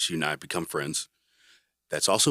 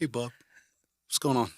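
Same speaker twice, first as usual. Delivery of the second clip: higher, friendly Arkansas drawl; David's Arkansas drawl; 7 semitones higher than usual. Retold or imitated. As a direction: drawl it out like an Arkansas big brother. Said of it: "Has that nice Arkansas drawl".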